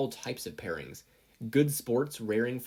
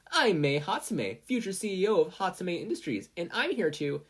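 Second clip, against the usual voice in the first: eager inventor pitch; 8 semitones higher, 3 dB louder than usual. Acted. Mei introducing herself to investors, proudly. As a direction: announce yourself like a CEO.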